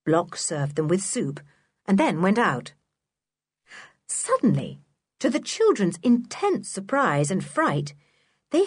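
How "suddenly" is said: usual speaking voice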